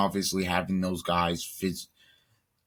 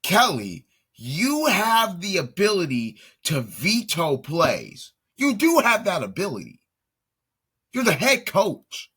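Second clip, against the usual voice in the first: rising, louder frustrated rant at the coach; higher and louder with each line, fed up; exasperated rant; 9 semitones higher, 4 dB louder than usual. Real, not acted. Commentator venting at the head coach, exasperated and louder.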